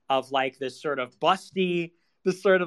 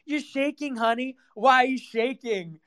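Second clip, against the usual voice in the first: high, teasing sing-song; mocking flamboyant guy; 8 semitones higher than usual. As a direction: camp taunt, higher voice.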